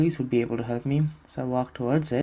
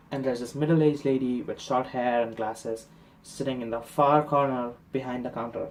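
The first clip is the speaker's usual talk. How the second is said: normal talking voice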